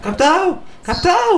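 high playful call across the room. Real, not acted. Friends tease, calling out playfully.

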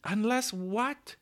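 high, drawn-out eager question; excited cry. A narrator's reading. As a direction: burst in, pitch leaping up with suspense. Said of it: "cried the children excitedly".

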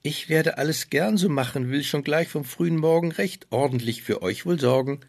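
gladly promising to help; happy young girl. Acted. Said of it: "Schneewittchen war vor Glück zum Lachen".

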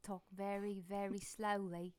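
measured Cockney meditation threat; Michael Caine. Acted. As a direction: stretch the words out in mock London anger. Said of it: "It's like having Michael Caine".

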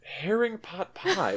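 slow, high incredulous echo; disbelieving. Real, not acted. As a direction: repeat it in laughing disbelief. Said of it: "What is wrong with you?".

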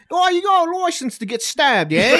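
put-on loud, high, laughing British cop accent; hammed-up British accent with a laugh; mock British accent. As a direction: do a cheeky London copper accent. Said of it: "He has to do the accent".

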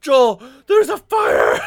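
slow, high mock-panic scream; hammed-up yell to a coworker; retold alarmed shout. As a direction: replay a drawn-out shout of alarm. Retold shout to coworker about fire.

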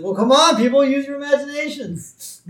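loud, very high-pitched protest; mock desperate outburst; exasperated plea. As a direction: beg the crowd to believe you, voice shooting up. Acting the reverend's desperate plea.

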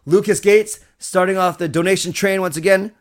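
usual speaking voice

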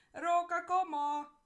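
very loud, high, slow call from the hilltop. Acted. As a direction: shout it down the hill. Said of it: "kiáltott le a nyúl".

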